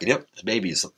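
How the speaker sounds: ordinary talk, nothing marked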